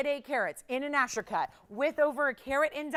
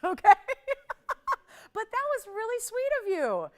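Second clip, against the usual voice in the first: laughing; 11 semitones higher, 6 dB louder than usual. Real, not acted. Host laughing with the caller.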